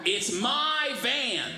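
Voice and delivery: high, very drawn-out mock panic; panicked. Man explaining himself to staring strangers, panicked. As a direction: stretch it out high, as a panicked excuse. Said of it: "I just panicked".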